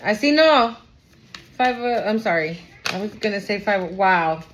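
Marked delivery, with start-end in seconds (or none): none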